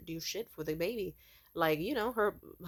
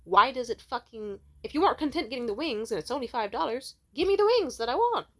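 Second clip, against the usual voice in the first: loud, rising angry rant; real flash of anger, much louder and higher; got mad; 4 semitones higher, 7 dB louder than usual. Real, not acted. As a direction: really lose it over the wings. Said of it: "Sorry, I got mad for a second there".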